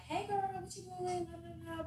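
put-on high, chirpy hey girl; bubbly phone greeting. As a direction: greet her like a bestie, way up high.

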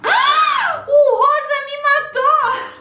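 sudden high yelled outcry; shocked shout. Real, not acted. Gamer shocked at being killed, high yelled outcry.

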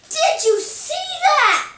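high, slow, loud disbelieving shout; excited shout. Real, not acted. Player shouting to viewers after triple kill, excited. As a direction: yell it at the screen in triumph.

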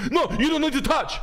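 high, loud, laughing mock panic; a hammed-up frightened passenger squeals; panicked yelp. Friend mimics panicked bus passenger, high laughing yelp. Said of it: "Chinese guy".

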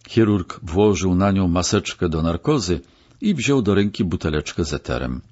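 plain reading voice

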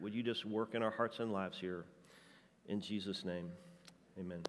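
soft, low pastoral prayer; hushed earnest prayer voice. Acted. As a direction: pray gently over the congregation. Leads a closing prayer.

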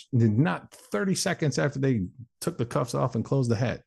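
ordinary talk, nothing marked

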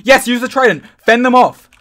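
loud, high-pitched urging; urgent shouting. Real, not acted. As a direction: call out instructions in a fight. Urgent instructions during attack.

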